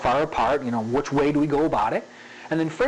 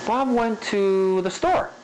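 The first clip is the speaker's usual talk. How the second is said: slow, higher, lingering on "to"; drawn-out "to" in a raised, slow line; varied spacing; 6 semitones higher, slower than usual. Shown as a demonstration. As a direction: stretch "went to", then let "the store" go. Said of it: "where you linger, and where you rush".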